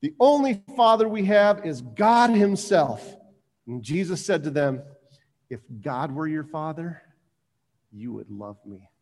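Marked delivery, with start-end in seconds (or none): 0.0-3.2 louder, forceful protest with punched words. Acted. Protesters insist to Jesus on their father, loud protest. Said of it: "I guess they're protesting". 5.5-8.9 soft, sinking to a low hush; hushed reply. Acted. Jesus answers the protesting crowd, hushed and grave.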